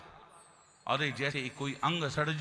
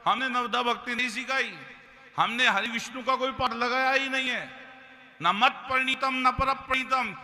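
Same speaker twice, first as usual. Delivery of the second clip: pleading; 9 semitones higher, 4 dB louder than usual. Acted. Guru keeps pleading to king.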